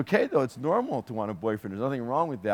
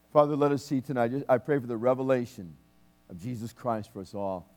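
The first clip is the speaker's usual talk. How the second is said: fervent prayer. Acted. Minister praying aloud over the church, fervent prayer. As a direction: pray over the congregation with fervor.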